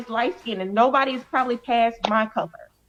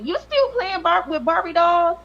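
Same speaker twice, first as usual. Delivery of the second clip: higher-pitched imitation of a ridiculing mother; mocking mom; 7 semitones higher than usual. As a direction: mimic mom poking fun, pitched up. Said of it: "my mom would laugh at me".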